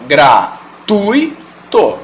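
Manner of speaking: "gratuito" slowly as GRA, TUI, TO; correct diphthong. Shown as a demonstration. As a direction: keep UI in one syllable in "gratuito". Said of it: "elas não têm essa força".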